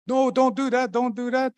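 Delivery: high squeaky fake-panicked trickster voice; pleading so they'll do exactly that; mock panic. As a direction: beg in a high voice, hamming up the terror. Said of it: "he says".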